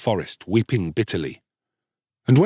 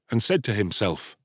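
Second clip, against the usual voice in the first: ordinary delivery, nothing marked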